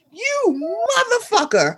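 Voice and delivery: high, slow disbelieving curse; shocked curse. Retold or imitated. To a Backstreet Boy on Parler, high stunned curse. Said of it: "I'm like".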